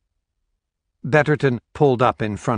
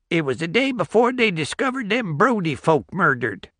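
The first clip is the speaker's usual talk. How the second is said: put-on old country porch-sitter; 8 semitones higher than usual. Old man recalling the date.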